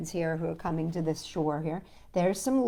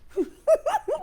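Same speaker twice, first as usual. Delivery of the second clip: very high chuckling laugh; turtle laugh; 5 dB louder than usual. Acted. Turtle laughing at Toad's suit. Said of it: "The turtle laughed".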